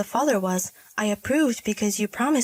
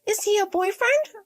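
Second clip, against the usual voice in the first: put-on "boyfriend" lifted as an unsure question; high-pitched, doubting "boyfriend"; 10 semitones higher than usual. Daughter unsure of her answer, "boyfriend" said as a question. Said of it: "Why are you saying it like a question?".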